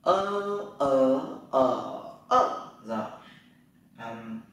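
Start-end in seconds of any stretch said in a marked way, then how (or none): 0.0-2.7 "Ơ" held long through four tones; e read as "ơ". Shown as a demonstration. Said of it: "E đọc là ơ nhá". (đọc "ơ" qua bốn thanh điệu)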